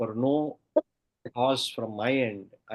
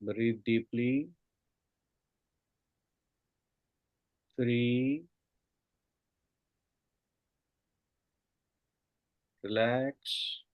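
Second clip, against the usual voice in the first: soft spa whisper; 4 dB quieter than usual. Acted. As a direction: lull them down with each count. Guided meditation countdown.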